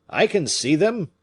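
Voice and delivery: crying out. Acted. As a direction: call out what you spot. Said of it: "cried he".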